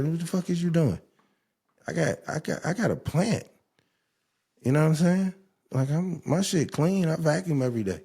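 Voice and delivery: worked-up rant. Real, not acted. Ranting about guests wearing shoes in bed, high and worked up. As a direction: insist your place is spotless, voice climbing.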